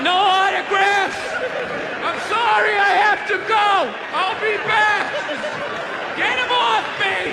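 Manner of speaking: flee the fans like a star; mock rock star. Drunk acts like fleeing star.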